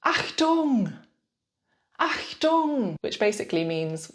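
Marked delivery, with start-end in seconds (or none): 0.0-3.0 shout. Shown as a demonstration. Cyclist shouts at a pedestrian stepping into the lane. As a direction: call out to stop someone stepping out. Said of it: "Then you could shout".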